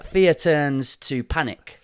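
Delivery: slow, deliberate fear turns to panic. Real, not acted. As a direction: lay it out slowly, word by word.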